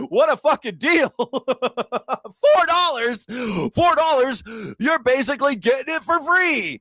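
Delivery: high, giddy bargain-hunter glee; mock delight. Mimicking happy drivers at four dollars, giddy mock delight. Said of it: "that's the mindset now".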